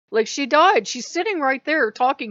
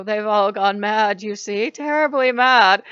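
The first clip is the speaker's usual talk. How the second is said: mock-dramatic actress, loud and drawn out; theatrical guru impression; 7 dB louder than usual. Mocking the guru woman on video, theatrical. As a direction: ham it up like an old film star. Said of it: "she is doing it too".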